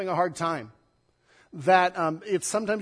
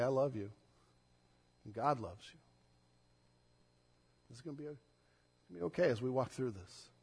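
soft, low and gentle; consoling a mourner with your arms around them; tender comfort; 5 semitones lower, 9 dB quieter, faster than usual. Retold or imitated. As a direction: hold them close and speak quietly. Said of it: "put our arms around them and say".

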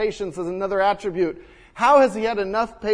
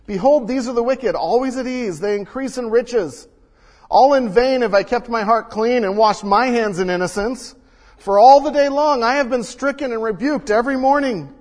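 louder, higher proclaiming of the psalm; scripture read with force; 4 semitones higher, 6 dB louder than usual. Acted. Pastor reading the psalmist's complaint, loud and higher.

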